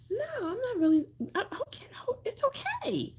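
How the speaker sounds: reassuring. Real, not acted. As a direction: soothe him kindly, high and soft. Reassuring viewer not religious.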